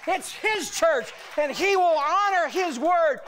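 forceful, driving sermon delivery; full-force preaching. Acted. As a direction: proclaim defiance with every phrase punched.

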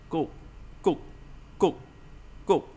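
"cúc" repeated with a puff of air; airy consonant. Shown as a demonstration. Teacher shows learners the air coming out, "cúc" with a puff. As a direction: let air out on each short "cúc". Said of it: "âm gió ra".

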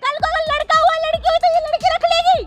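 agitated, high-pitched arguing; heated protest. Acted. Daughter-in-law fearing she will be thrown out, heated protest. Woman protests about her future child.

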